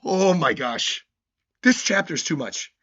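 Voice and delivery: normal talking voice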